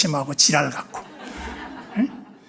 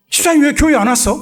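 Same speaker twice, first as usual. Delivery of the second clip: louder, higher calling voice; 8 semitones higher, 5 dB louder, faster than usual. Retold or imitated. Pastor calling the deacon who stopped coming, raised call.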